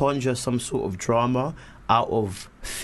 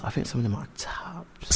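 whispering; 6 dB quieter than usual. Real, not acted. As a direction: giggly whisper on air. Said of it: "You're whispering on radio".

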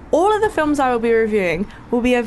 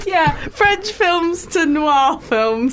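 mocking; 5 semitones higher, much slower than usual. Mocking co-host's film taste.

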